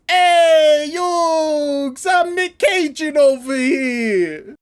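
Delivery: loud drawn-out yell, slow on the last phrase; Nicolas Cage shout. Retold or imitated. Nicolas Cage calls to Yugi from inside the throat, loud and slow. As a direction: bellow the catchphrase, hammed up. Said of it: "he says".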